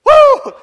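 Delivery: high, loud church whoop; ecstatic whoop. Real, not acted. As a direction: cry out in praise. Preacher whoops.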